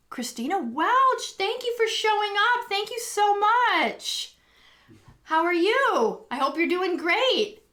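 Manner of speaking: high, delighted welcome to a viewer; beaming hello, slowing on the thanks; thrilled greeting. Real, not acted. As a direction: call out the names with real joy.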